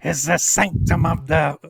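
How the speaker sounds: slow, loud booming guardian voice. Acted. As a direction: boom it out like a temple guardian. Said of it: "in a booming voice".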